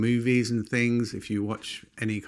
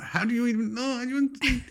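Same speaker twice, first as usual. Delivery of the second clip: much higher, amazed questions; high incredulous; 13 semitones higher, faster than usual. Retold or imitated. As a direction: ask it high and astonished. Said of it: "and I'm like".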